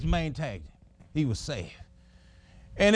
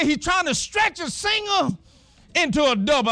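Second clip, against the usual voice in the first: higher, thrilled narration of the run; excited cheering; 8 semitones higher than usual. Retold or imitated. Father watching son stretch a hit, excited. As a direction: cheer him on as he rounds first. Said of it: "I said".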